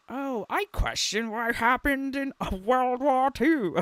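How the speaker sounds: half-hearted Australian accent, mocking; a bad Australian accent in a sneering quote; weak attempt at an Australian accent; failed Australian accent. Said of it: "Oh, I can't do an Australian accent".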